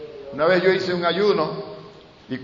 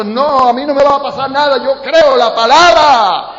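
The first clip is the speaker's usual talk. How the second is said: fiery preaching; 7 semitones higher, 7 dB louder than usual. Acted. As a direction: proclaim it boldly to the church. Preaching faith declaration. (proclamación de fe a gritos)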